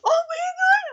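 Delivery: very high, squealed overwhelmed cry; mimicked freak-out. Host mimicking fan holding a bunny, freaking out. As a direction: squeal it as if overcome by cuteness. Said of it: "starts freaking out".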